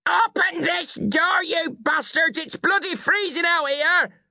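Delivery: put-on very high, drawn-out drunken yell; angry yelling. A narrator's reading. Drunken mother banging on the front door, yelling furiously. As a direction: yell to be let in. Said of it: "then she was yelling".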